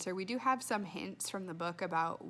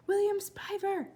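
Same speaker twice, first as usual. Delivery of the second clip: high call up to a window; 10 semitones higher than usual. Acted. Girl calling up to a neighbour's window, high call. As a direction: call his name into the night. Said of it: "she said again".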